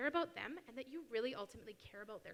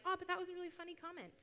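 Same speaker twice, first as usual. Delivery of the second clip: smug inner voice; 7 semitones higher, faster than usual. Retold or imitated. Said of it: "I might be being like".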